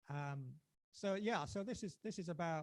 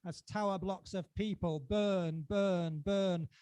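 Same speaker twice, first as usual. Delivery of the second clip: each burn struck louder and falling; hammered repetition; 5 semitones higher, 10 dB louder, much slower than usual. Acted. Poet hammering the refrain at the crowd, louder. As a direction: pound out burn, burn, burn.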